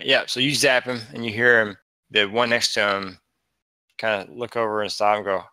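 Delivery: ordinary delivery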